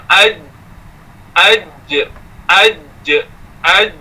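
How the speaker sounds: "aj" with a closed, held j and no breath escaping; "aj" repeated, j pressed tight with no puff of air; letter sound demo. Shown as a demonstration. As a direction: stop the air firmly on the j of "aj". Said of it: "Ha, itu bunyi dia".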